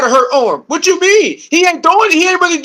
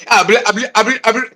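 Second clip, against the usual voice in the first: mock stuttering; 4 semitones lower, much faster than usual. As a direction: fake stammering babble. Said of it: "they start stuttering".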